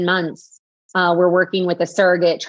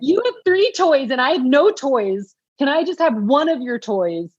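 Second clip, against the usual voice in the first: put-on higher, sulky little kid voice; whiny child; 7 semitones higher than usual. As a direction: pout like a kid who wants a sibling's toy. Comparing the babies to a child's toys.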